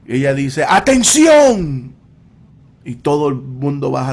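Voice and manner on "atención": louder, higher shout, last syllable held long; authoritative shout. Retold or imitated. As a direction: command a crowd to fall silent. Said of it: "grita con una voz de autoridad". (grito alto y alargado, con voz de autoridad)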